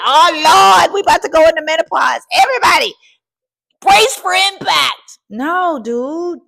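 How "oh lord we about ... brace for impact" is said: high, loud hammed-up alarm; mock panic, much higher and louder. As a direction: cry out the alarm like a panicked church lady. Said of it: "you're like".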